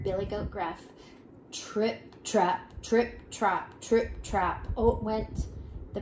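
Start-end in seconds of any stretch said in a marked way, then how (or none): 1.5-4.7 slow, weighty hoof steps; heavy trip-trap. A narrator's reading. Heavy third goat crosses the bridge, weighty trip-trap. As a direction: plod each word out with heavy separate steps.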